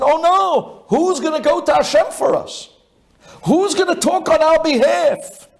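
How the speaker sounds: high-pitched, alarmed wail of a crowd; dramatized high-pitched dismay; acted panic. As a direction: cry out as if suddenly left leaderless. Acting out the people's panic.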